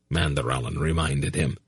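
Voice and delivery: usual speaking voice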